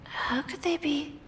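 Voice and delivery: soft, hesitant musing; quietly uncertain, with pauses. Acted. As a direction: wonder aloud, a little unsure. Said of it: "She sounds a little hesitant".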